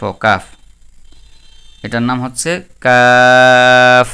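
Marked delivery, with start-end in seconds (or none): 1.8-4.1 louder, slow, কাফ held long; drawn-out kaf. Shown as a demonstration. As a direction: teach কাফ by stretching it. (কাফ জোরে টেনে)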